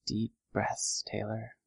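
soft self-soothing murmur; telling oneself. A narrator's reading. Narrator voices a girl steadying herself in the bathroom, quietly. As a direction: say it quietly, as if to yourself. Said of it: "I told myself".

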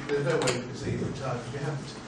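usual speaking voice

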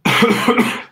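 sharp dry cough; hard rasping cough; coughing fit. Real, not acted. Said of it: "excuse my coughing".

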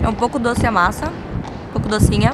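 eating and speaking at once; chewing while talking. Real, not acted. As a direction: mouth partly full of bread. Seen 0: Bites bread at -9; jaw and lips keep chewing while speaking.

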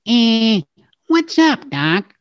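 high "Eh, what's up" Bugs voice; full Bugs Bunny. Shown as a demonstration. Demonstrating the full catchphrase for the host. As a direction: put eh and attitude together like Bugs. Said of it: "put the two together".

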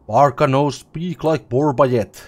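loud, blunt troll speech. Acted. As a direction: bellow it like a dim troll. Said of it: "the second troll says".

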